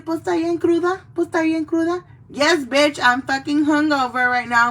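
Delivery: loud mock hungover griping; hammed-up hungover voice. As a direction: play it up like a hungover relative at breakfast. Acts out hungover self.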